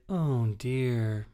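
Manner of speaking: lightly put-on actress line reading; a mildly mocking imitation of a film line; imitating Greta Gerwig. Critic imitating an actress's awkward line reading, lightly mocking. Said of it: "when she's like".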